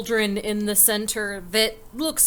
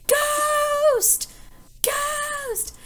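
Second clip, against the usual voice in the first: high, drawn-out mock shriek; screaming in fright. Bartender flees the see-through woman, high frightened scream. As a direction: cry out while running away in terror. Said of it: "and runs off screaming".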